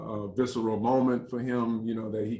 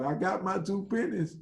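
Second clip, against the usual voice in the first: mock proud shout, pitched higher; calling out his pennies half-acted; hint of a triumphant yell; retold yell; 8 semitones higher than usual. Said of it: "he yells out".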